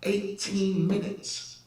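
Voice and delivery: high, heated recounting; still angry retelling. Real, not acted. Telling the host about the driver, still heated and high. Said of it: "I was so angry".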